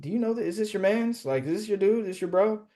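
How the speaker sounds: high, rising mock interrogation; retold incredulous questioning. Asking his contact about the slimy wholesaler, high incredulous questions. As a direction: pitch it up, each question rising.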